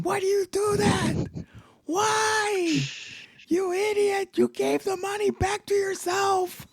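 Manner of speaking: very high-pitched screamed outburst; screaming hacker. Retold or imitated. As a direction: imitate a scammer screaming in outrage. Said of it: "you could hear the hacker all screaming".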